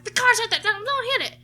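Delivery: high, loud, stumbling yell; panicked warning. Retold or imitated. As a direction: reenact frantic shouted directions to a driver. Retells yelling directions at driver.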